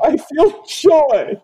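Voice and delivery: high, slow breathy-free falsetto mock of Troi. Mocking the counselor to his cohost, high slow falsetto. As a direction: ham up the feeling empath, slowly. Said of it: "painful portrayal of women".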